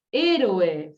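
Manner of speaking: Héroe said with a clear punch on the stressed vowel; teacher models Héroe, stress pushed out loud; stressed word demo. Shown as a demonstration. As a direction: model Héroe for the class, leaning on the stressed syllable.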